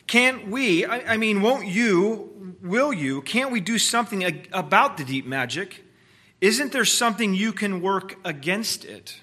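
put-on a young girl begging, tripping over words; pleading girl voice. A narrator's reading. Susan pleads desperately, stammering.

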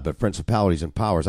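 normal talking voice

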